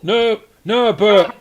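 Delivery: loud, high lament; mock grief. Game master voices cultist mourning a comrade, loud mock grief. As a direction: protest loudly, hammed-up mourning.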